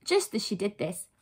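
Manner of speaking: usual speaking voice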